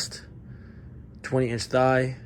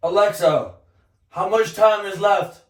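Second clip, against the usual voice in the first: loud call, higher; 6 semitones higher, 6 dB louder than usual. Real, not acted. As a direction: call out across the room. Calling to Alexa from bath.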